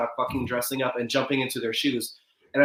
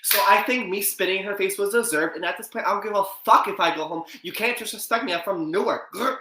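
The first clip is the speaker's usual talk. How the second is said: reality show confessional; 6 semitones higher than usual. Pretend. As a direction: play a defiant reality star. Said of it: "give me right now your confessional".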